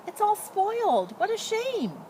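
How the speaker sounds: high, slow, sorrowful sigh of regret; saddened teacher. A narrator's reading. Teacher sees the ruined painting, sad and slow. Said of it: "he heard the sadness in her voice".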